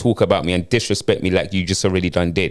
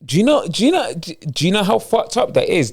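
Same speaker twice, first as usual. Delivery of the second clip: higher, incredulous outrage; outraged; 8 semitones higher than usual. Acted. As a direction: demand it in disbelief. Boyfriend furious at betrayal.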